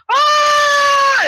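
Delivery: long, high, loud mock scream; falling scream. Hero dropped by robot arm, mock scream. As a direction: scream as you are dropped.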